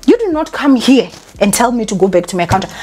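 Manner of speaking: ordinary delivery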